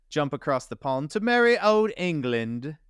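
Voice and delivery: a light put-on old British accent; a hint of an old-timey British accent. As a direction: lilt it like merry old England in a British accent. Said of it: "I don't know why I always do that voice".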